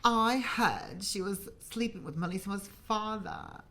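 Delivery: put-on British gossip accent; best UK accent. Friend passes on gossip, mock UK accent. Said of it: "give me your best U K accent".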